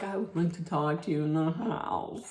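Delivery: low, quiet mock confidential tone; mimicking a quiet word in private; hushed request. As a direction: pull her aside in a hushed voice. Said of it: "she's like".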